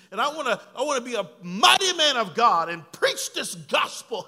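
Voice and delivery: loud, high shouted sermon; full-force preaching. Acted.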